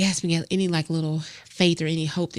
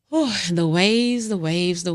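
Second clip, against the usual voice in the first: drawn-out whew; 2 semitones higher, 5 dB louder, slower than usual. Real, not acted. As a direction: let the weight pour out in a big exhale. Overwhelmed by a month of grief.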